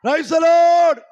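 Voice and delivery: rally the church with a shout; ringing call of praise from the pulpit. Real, not acted. Climax of the sermon build.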